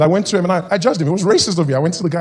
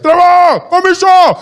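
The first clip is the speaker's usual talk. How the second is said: put-on high, loud summoning yell in an Afrikaans accent; giant Afrikaner's shout; 12 semitones higher, 8 dB louder, much slower than usual. Giant Afrikaans fan calling him over, booming shout. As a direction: summon him from across the street. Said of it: "He's like".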